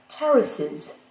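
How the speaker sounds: "terraces" slow, first syllable strong; stress on first syllable. Shown as a demonstration. Teacher showing the unchanged pattern, "terraces" stressed on the first syllable. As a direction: punch the start of "terraces", leave the inflection weak. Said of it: "pas le schéma accentuel".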